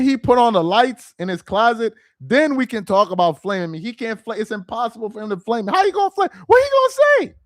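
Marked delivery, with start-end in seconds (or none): 5.7-7.3 much higher and louder, mocking disbelief; incredulous outburst. Real, not acted. Streamer scoffing at a rival's insult, high and loud. Indignant rant at critic.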